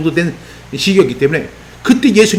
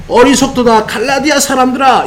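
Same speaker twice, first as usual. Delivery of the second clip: raised, higher-pitched lament as a rebuke; 통탄하는 바울; 6 semitones higher than usual. Acted. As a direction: cry out in dismay at them, pitched up. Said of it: "얼마나 통탄을 했습니까". (통탄하듯 높여 꾸짖는 말투)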